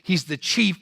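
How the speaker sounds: ordinary talk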